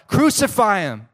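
the crowd demanding death, loud and high; crowd shouting. Retold or imitated. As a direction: yell it out as the mob. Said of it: "they shout".

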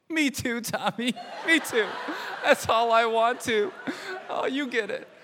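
laughing. Real, not acted. Mother laughing as she answers her toddler son. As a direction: laugh helplessly while answering.

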